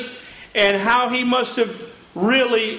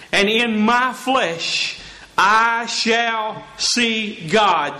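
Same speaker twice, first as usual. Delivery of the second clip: slow scripture reading; much slower than usual. Acted. Pastor quoting Job slowly.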